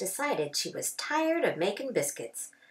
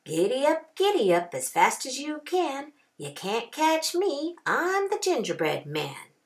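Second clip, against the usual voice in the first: higher, sing-song teasing chant; taunting gingerbread cowboy; 6 semitones higher than usual. A narrator's reading. Gingerbread cowboy taunting the rancher, sing-song. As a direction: tease them from a safe distance. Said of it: "just laughed and said".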